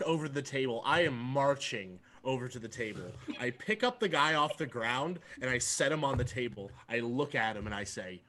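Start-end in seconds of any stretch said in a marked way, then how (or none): none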